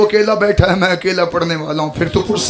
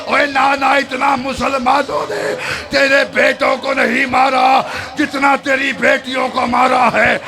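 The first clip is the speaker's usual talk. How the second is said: wailing cry; 5 semitones higher, slower than usual. Acted. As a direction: cry out to the grave with all grief. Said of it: "बीबी की आवाज़ आई".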